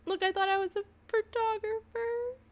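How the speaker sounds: high, tearful voice; crying. Real, not acted. Streamer looking at old dog photos, crying. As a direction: get the words out while crying. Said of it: "Crying b-, to myself".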